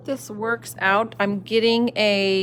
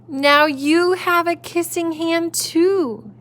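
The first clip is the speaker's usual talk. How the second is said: put-on proud child voice; 9 semitones higher, 5 dB louder, much slower than usual.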